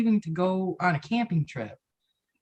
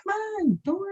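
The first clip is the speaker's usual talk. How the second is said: high coaxing voice to a donkey; faster than usual. Retold or imitated. Friend coaxing a donkey in, high and coaxing. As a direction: sweet-talk it in, way up high.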